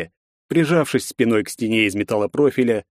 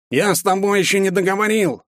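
high nasal street thug; 10 semitones higher than usual. Acted. Thug presses the girl, nasal and pushy. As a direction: drone it like a pushy gopnik. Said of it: "прогундосил второй голос".

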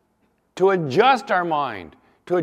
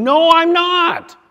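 shouted high, slow "No, I'm not"; cornered Peter yelling back; desperate denial; 13 semitones higher, 12 dB louder, slower than usual. Retold or imitated.

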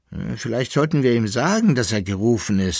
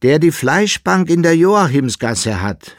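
higher and louder, adding a clarification; explaining second voice; 7 semitones higher, 8 dB louder than usual. A narrator's reading. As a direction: chime in to make sure nobody's confused. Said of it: "fügte eine andere Stimme erläuternd hinzu". (erläuternd ergänzende zweite Stimme, höher und lauter)

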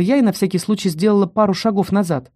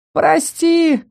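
moaning through laughter; 9 semitones higher than usual. A narrator's reading. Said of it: "простонала она".

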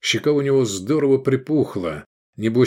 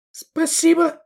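tiny, very high falsetto thanks; squeaking; 3 dB louder, slower than usual. A narrator's reading. Octavia thanking Sergei for treasure, high squeak. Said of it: "пискнула Октавия".

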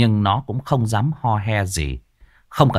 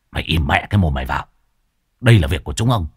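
lower, fast rough rebuke; gruff exorcist; 6 semitones lower, much faster than usual. A narrator's reading. Exorcist glaring at the ghost, lower and harsh. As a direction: snap the ghost into silence. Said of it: "Thầy Phọt trợn mắt".

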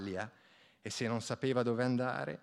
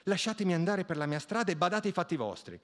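higher, louder shriek of protest; shrieking girl; 6 semitones higher, 4 dB louder than usual. A narrator's reading. Peasant girl shrieking at pestering young men, loud protest. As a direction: shout them off her. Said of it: "si mise a strillare".

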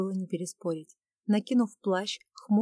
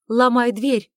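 frantic, loud scream of an order; terrified shout; 4 semitones higher, 11 dB louder, slower than usual. Acted. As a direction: scream it, beside herself with terror. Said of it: "вне себя от ужаса закричала".

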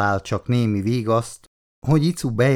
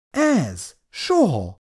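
confident exclamation; 6 semitones higher, much slower than usual. A narrator's reading. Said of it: "Kiáltott fel az asszony magabiztosan".

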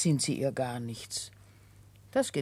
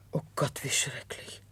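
horrified breath of a whisper; shocked whisper; 5 dB quieter than usual. Acted. Housekeeper hears Katjuscha was convicted, whispers in shock. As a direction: gasp it out, barely voiced.

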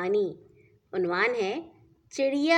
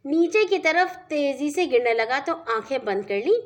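plain reading voice

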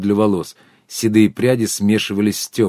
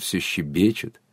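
softer, lower contented echo; 5 semitones lower, 7 dB quieter, slower than usual. A narrator's reading. Sergei repeating his tease to himself, softly contented. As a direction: let it sink to a quiet, satisfied aside. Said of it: "сказал удовлетворённо".

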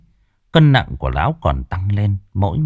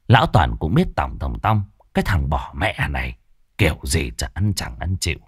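ordinary delivery, nothing marked

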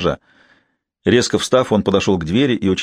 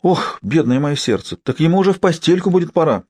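high flustered gasp; gasping old nanny; 8 semitones higher than usual. A narrator's reading. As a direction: fret over the baby. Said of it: "ахнула няня".

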